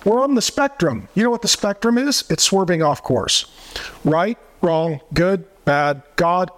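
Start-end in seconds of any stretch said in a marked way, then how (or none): 0.0-1.1 high, whiny mock denial; mocking protest. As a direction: parody their protest in a high voice. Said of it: "they'll say".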